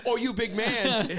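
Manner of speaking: teasing big man in a mock Mexican accent, slowed; lightly put-on Mexican accent, drawn out. Friend corrected, redoes tease in mock Mexican accent. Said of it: "You were in Mexico".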